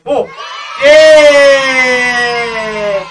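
drawn-out loud yell; loud shout. Real, not acted. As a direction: yell out at the scene. Shout at game ending.

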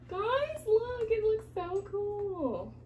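high, stretched-out excitement; excited awe. Real, not acted. Painter showing guys the pour, excited awe. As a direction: gush slowly with the pitch way up.

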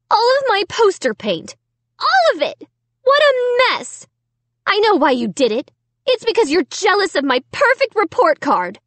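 put-on high, loud outraged girl; outraged accusation. A narrator's reading. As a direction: accuse her sister bitterly.

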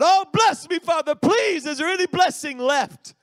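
crying plea. Acted. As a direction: wail it like a son begging through tears. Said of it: "Esau began to cry".

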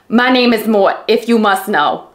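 exaggerated New York door-handle character; New York accent. Reader imitating an audiobook's talking door handle, exaggerated New York accent. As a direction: ham up a thick New York accent, badly. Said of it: "this New York accent".

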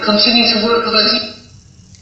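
high, distant voice through radio static; thin crackly spirit box voice; static entity. Retold or imitated. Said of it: "I heard that".

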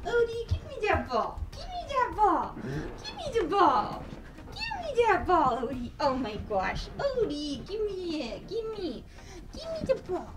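high, sing-song begging. Real, not acted. Begging Odie to hand over the ball, high sing-song. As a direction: coax the ball out of his mouth. Pleading dog to give ball.

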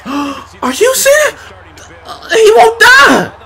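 very high-pitched yelling; high frustrated wail; outraged disbelief. Real, not acted. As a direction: squeal in exasperation at the game. Opponent kicks out of pin.

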